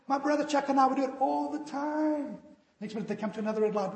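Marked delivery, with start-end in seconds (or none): 0.1-2.4 higher, loud easygoing reassurance; carefree driver. Retold or imitated. Driver brushing off his scared friend, breezy and loud. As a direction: wave off the worry, breezy.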